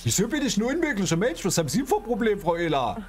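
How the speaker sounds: indignant. Acted. Acted quarrel, insulted by Ehler.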